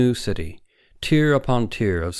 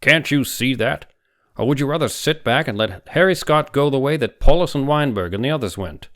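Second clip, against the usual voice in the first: heated challenge; 6 semitones higher, 5 dB louder than usual. A narrator's reading. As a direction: demand he see the danger. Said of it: "his dark eyes flashing".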